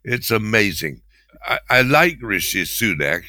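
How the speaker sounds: normal talking voice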